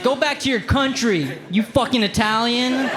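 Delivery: high, lightly voiced yell. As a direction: jeer it, half laughing. Imagined racist yelling.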